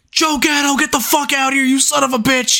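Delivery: high, strained heckle; mock yelling. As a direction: yell up at someone far off, without full volume. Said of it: "just start yelling to Joe Gatto".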